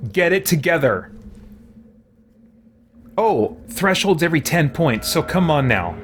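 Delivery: ordinary talk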